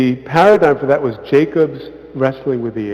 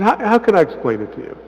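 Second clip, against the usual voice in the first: quoting the elder; 7 semitones higher, faster than usual. Retold or imitated. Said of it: "He said".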